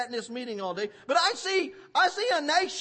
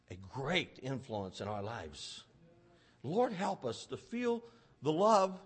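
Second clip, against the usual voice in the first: low, hushed prayer with long pauses; quiet, pleading prayer; earnest prayer; 9 semitones lower, 4 dB quieter than usual. Real, not acted. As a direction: pray softly and earnestly from the pulpit.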